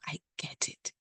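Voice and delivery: soft whispered aside. Real, not acted. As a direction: breathe it out quietly. Sincere aside after painful history.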